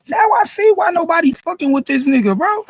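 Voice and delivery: angry rant. Real, not acted. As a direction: yell your disbelief at him. Ranting louder at the rapper.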